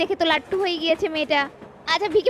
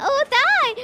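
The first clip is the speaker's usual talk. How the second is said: high giggle, then a delighted squeal; flattered giggle; 8 semitones higher, much slower than usual. Acted. Girl giggling when the photographer calls her a model.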